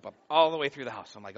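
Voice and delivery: ordinary talk, nothing marked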